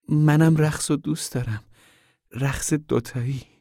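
cautious. A narrator's reading. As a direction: admit it carefully, easing off at the end. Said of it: "روزا شارون محافظه کارانه گفت".

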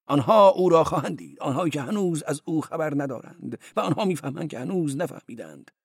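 high, loud then fading recital; 성경을 읊듯; scripture recital. Acted.

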